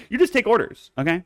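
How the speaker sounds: high, loud mock command; mock dictator. Ordering viewers as their supreme leader, mock bossy.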